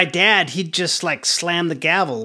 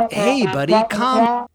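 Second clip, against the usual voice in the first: higher, put-on placating voice; Palo Alto therapist; 6 semitones higher, 4 dB louder, slower than usual. Therapist to angry teen at the desk, mock placating. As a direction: mimic a therapist soothing an upset kid. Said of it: "like the Palo Alto therapist".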